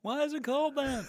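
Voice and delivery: high, whiny stand-up bit; Jerry Seinfeld. Acted. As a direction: puzzle over it like an observational comic. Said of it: "that's a Jerry Seinfeld joke".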